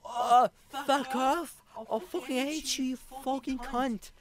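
high mock British yelling; mock British shouting match; angry British man. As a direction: ham up a furious bloke on the metro. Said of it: "le gars fait comme".

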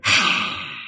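long breathy hiss; vampire hiss. Acted. Vampire wife told about recording today, hostile hiss. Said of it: "don't hiss at me".

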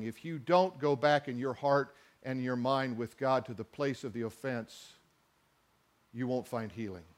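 quiet earnest appeal; hushed appeal. Real, not acted. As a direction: draw them in with a near-hush. Pastor drops voice for appeal.

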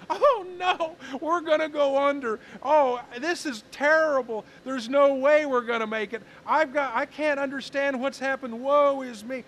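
high, loud mock wailing despair; hammed-up sobbing lament; dramatic sigh. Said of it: "I could have said things like".

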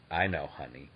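low, soft humouring tone; imitated wife. Host voicing his wife humouring him, low and soft. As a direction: quietly humour an excited spouse. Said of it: "and she'd be like".

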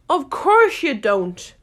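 slow, loud scornful Hatter; drawn-out scornful put-down; contemptuous. A narrator's reading. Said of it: "tossing his head contemptuously".

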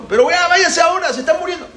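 very high, loud plea; urgent yelling. Acted. Pharaoh begs them to leave as people die, yelling. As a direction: yell it in high-pitched urgency. Pharaoh desperately urges departure.

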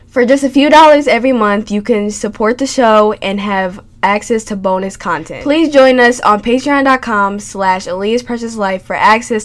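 upbeat podcast intro read. Acted. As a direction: read the sponsor spiel brightly.

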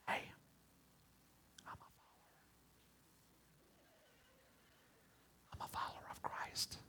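secretive whispered confession; mocking a timid believer's whisper. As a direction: hide your faith in a whisper. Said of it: "whispering people don't want nobody to know".

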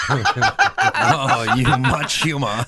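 laughing through the words; giggling while quoting a line. Real, not acted.

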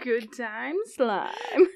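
drawn out slowly through laughter; laughing. Real, not acted. Co-host relishes the silly phrase, laughing. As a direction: savor the phrase while cracking up. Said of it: "such a good word".

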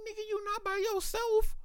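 very high, thin disbelieving squeak; incredulous squeak. Retold or imitated. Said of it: "I'm thinking this in my head".

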